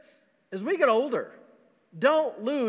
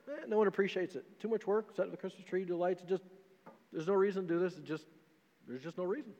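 put-on glum older man; 9 dB quieter than usual. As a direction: sound worn out and done with it. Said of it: "older man answers pastor".

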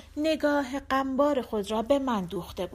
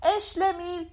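called out; 8 semitones higher than usual. Acted. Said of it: "داد زد".

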